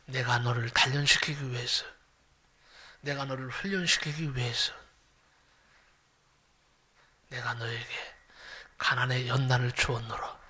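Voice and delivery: low, hushed fatherly God voice, swelling at promises; a lightly voiced gentle divine father. Pastor voicing God answering his tearful prayer, low and hushed. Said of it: "그날 하나님의 음성을 들었어요".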